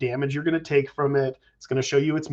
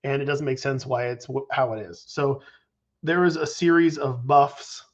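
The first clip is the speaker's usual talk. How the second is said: normal talking voice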